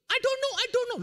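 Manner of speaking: high, loud flustered protest; panicked denial. Retold or imitated. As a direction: blurt it out in a frightened panic. Panicked reply to officer. (menjawab petugas dengan panik dan keras)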